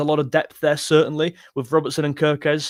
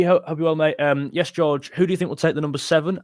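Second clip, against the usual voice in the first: ordinary talk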